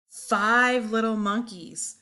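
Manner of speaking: ordinary delivery, nothing marked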